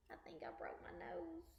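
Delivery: hushed, breathless whisper. Acted. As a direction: tell it in a secret whisper. Boy tells of crash, whispers.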